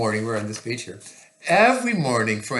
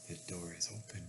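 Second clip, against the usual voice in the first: little gasp, then quick soft aside; soft, quick storybook astonishment; hushed mock surprise; 6 semitones lower, 14 dB quieter, much faster than usual. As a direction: gasp and half-whisper it to a child. Mock gasp at open door.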